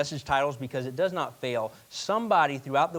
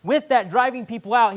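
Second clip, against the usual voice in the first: ordinary delivery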